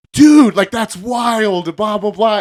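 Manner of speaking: high voice, loud opening; amazed retold exclamation. Imitating husband thrilled by wife's news, excited amazement. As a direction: hit the first word hard, acting amazed.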